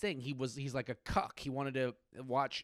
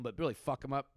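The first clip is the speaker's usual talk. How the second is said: usual speaking voice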